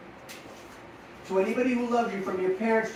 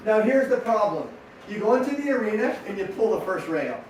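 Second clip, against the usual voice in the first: ordinary delivery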